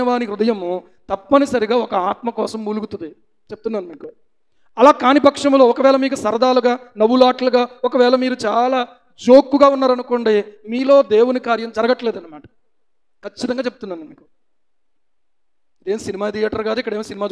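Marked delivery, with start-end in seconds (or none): 4.8-12.4 louder, higher rebuke; stern pulpit warning; fiery preaching. Acted. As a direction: warn the congregation firmly. Preaching rises to full force.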